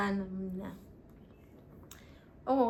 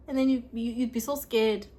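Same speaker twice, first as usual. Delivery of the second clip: usual speaking voice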